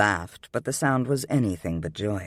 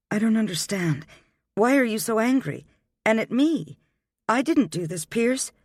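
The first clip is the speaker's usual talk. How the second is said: put-on higher, bewildered young woman protesting; 10 semitones higher than usual. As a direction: push back, hurt and confused, pitched high.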